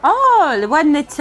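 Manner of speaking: light up as it comes back to you; sudden recognition. Real, not acted.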